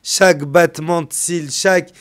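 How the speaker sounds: high, loud, impassioned sermon climb; preacher rising to a loud impassioned climax; fiery preaching. Acted. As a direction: build the sermon to a loud peak. Preaching at full force.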